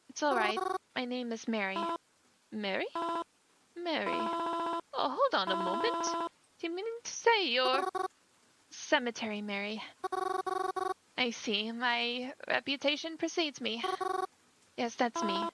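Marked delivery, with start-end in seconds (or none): 3.7-9.8 higher, startled realization; suddenly realizing who she is; surprised recognition. Acted. Mother realizes the stranger is Cemetery Mary, startled.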